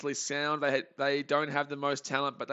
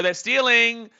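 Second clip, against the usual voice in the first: higher, louder cry of dismay; real alarmed shout; 8 semitones higher, 10 dB louder, slower than usual. Real, not acted. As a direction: yell it as the runner takes the base. Reacting to stolen base.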